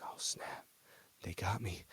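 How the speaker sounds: hushed, scared whisper; panicked whisper. Retold or imitated. Hernandez fearing his agent's call, frightened whisper. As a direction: breathe it out like you're caught.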